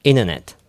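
"internet" said as "inner-net"; T dropped. Shown as a demonstration. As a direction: swallow the T so it's "innernet". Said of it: "イナーネットですよ". (「イナーネット」のように発音)

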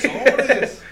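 excited laughing cheer. Retold or imitated. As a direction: cheer it like a yes. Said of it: "eco del grito". (¡órale! con risa, más fuerte y agudo)

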